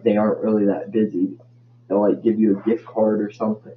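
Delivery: ordinary talk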